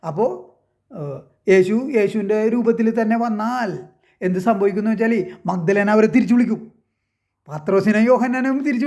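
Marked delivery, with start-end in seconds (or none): none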